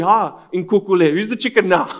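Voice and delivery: loud retold taunt, full of glee; mocking. Scared friend gets his own back on the mocker, mocking taunt. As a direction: throw the tease back at him loudly. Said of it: "standing there mocking him".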